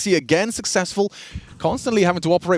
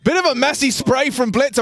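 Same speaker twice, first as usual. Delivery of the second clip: excited shout; 11 semitones higher than usual. Real, not acted. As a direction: shout it as the play lands. Caster hypes big play.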